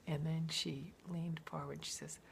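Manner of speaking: hushed confiding. Retold or imitated. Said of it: "And she said".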